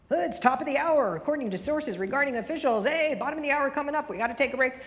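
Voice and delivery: loud, high, fake-cheery DJ patter; radio speak. Shown as a demonstration. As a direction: ham up the slick announcer voice, all hype and no person. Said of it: "Are you using radio speak?".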